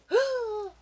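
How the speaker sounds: a sharp high intake of breath; high gasp. Acted. Dog Spunky gasping as he jumps for the canoe. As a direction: gasp mid-leap.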